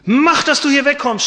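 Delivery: high, loud angry yell; shouting. Acted. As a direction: yell him away from the cell. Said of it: "schrie er".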